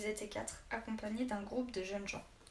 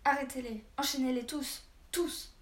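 loud, raised-pitch shouted order; furious yelling; 4 semitones higher, 7 dB louder than usual. A narrator's reading. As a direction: shout the command, climbing on the last word. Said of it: "hurla le baron".

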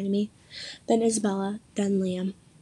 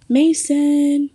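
loud, higher call of the name; yelled name; 5 semitones higher, 6 dB louder than usual. A narrator's reading. Friends calling for missing Mason, loud yell. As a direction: call out to find him. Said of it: "we yelled in unison again".

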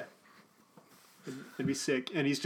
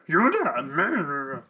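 wordless swooping warble around the name; Yoda; 4 dB louder than usual. Shown as a demonstration. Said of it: "can I do a good Yoda voice?".

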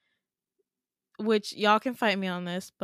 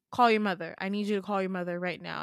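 quick, firm order in own pitch; faster than usual. Acted. Dad telling child to fetch mother, firm controlled order. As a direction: give a controlled, urgent instruction. Dad's first order, before escalating.

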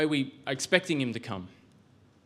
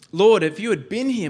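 louder, pained reproach, leaning on Lord; reproachful Martha; 6 semitones higher, 9 dB louder than usual. Retold or imitated. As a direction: voice Martha's hurt complaint to Jesus, louder. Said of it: "Martha is maybe a little bit disappointed".

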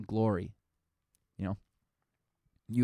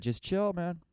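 high, laid-back priest voice; a soothing priest telling him to chill; mock calm priest urging him to relax; priest's advice; 9 semitones higher, slower than usual. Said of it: "And he said".

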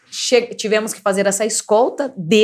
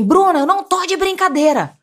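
nervous father; 8 semitones higher than usual. Retold or imitated. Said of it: "meu pai nervoso".

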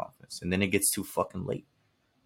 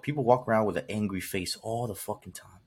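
usual speaking voice

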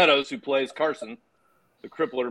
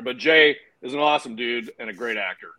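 ordinary talk

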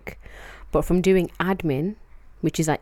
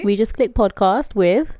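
podcast intro; 3 semitones higher, 5 dB louder, slower than usual. Acted. Podcast intro, name read slowly.

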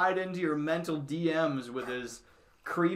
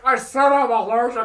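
whiny woman voice; 7 semitones higher, 11 dB louder than usual. Retold or imitated. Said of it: "She's like".

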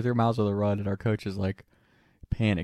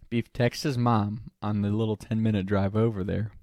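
ordinary delivery

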